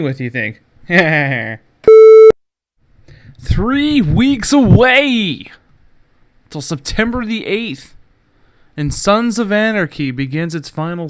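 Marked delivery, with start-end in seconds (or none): 3.4-7.9 very slow, loud, high announcer boom; trailer announcer. Acted. Host hyping the show's return, slow loud announcer. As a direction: drag it out like a trailer voice.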